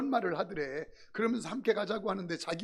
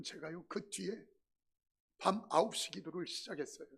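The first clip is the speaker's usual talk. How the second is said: ordinary delivery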